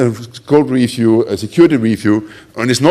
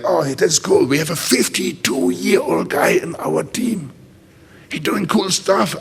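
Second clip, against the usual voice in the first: mock gushing youngsters; 5 semitones higher, 5 dB quieter, slower than usual. Young team gushing over older guy.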